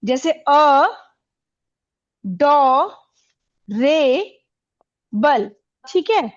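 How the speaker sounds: ए डो रे बल, each syllable alone with pauses; adorable spoken in four separate beats. Shown as a demonstration. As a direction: break adorable into ए, डो, रे, बल. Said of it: "vowel से".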